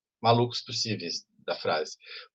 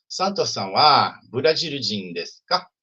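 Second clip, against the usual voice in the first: slow demo question, lifting on "ka"; "Santo-san wa Burajil-jin desu ka?" asked slowly, tune rising at "ka"; question intonation; 3 dB louder, slower than usual. Shown as a demonstration. Teacher models a Japanese question for the class, question intonation. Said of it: "a entonação vira entonação de pergunta".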